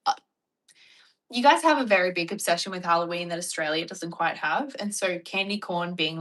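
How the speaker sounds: ordinary talk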